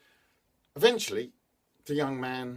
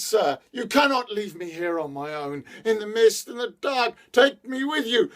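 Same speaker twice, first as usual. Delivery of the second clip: high, louder desperate pleading; pleading lady; 9 semitones higher, 7 dB louder than usual. A narrator's reading. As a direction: plead as he rides away. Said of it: "she continued to plead with him".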